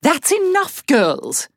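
high, firm mother scolding; stern mother. Acted. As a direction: cut them off like a mum.

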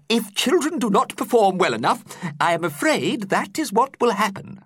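put-on high, fretful old teacher voice; worried teacher explaining. A narrator's reading. Teacher explaining to pupils in his high worried voice. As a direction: explain it with a nervous quaver in mind.

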